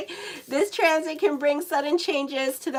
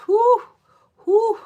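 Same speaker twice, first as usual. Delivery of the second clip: relieved whoo; 11 semitones higher, 9 dB louder than usual. Real, not acted. Relieved she is divorced.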